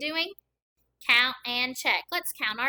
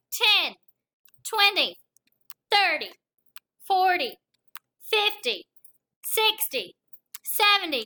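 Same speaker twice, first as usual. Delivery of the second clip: energetic counting; 6 semitones higher, 4 dB louder than usual. Real, not acted. Counting by tens while touching toes.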